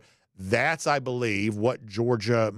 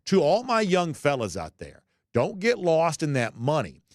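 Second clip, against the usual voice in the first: higher-pitched, projected reading of a player's post; emphatic read-aloud; 7 semitones higher than usual. Retold or imitated. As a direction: read it out like a rallying call.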